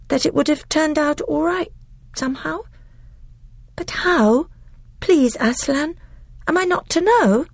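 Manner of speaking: put-on high, pleading little girl; a child begging to understand; young girl Lucy. A narrator's reading. Voices Lucy.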